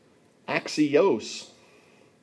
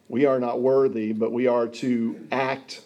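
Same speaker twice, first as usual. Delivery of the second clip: normal talking voice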